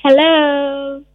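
overjoyed, high and loud; very happy. Shown as a demonstration. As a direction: sing out a delighted hello. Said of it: "hello in a very happy mood".

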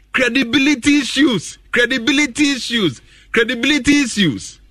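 high, ringing repeat of Credibility issues; emphatic repetition. Real, not acted. Pundit stressing credibility issues to listeners, high and emphatic. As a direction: hammer "credibility issues" home each time.

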